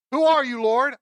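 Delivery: high, loud cry of a stunned man; Saul's startled question. Acted. Saul crying out to the voice from heaven, high startled call.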